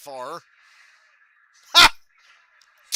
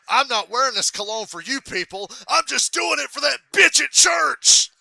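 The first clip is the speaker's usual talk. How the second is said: gruff shout; 10 semitones higher, 12 dB louder than usual. Retold or imitated. Dad brushing off his son's compliment, gruff shout. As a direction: bark the reply.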